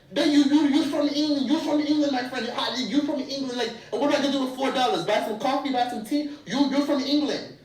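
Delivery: high-pitched, loud agitated protest; retold yelling. Driver arguing over four dollars, yelling. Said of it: "Like, literally yelling".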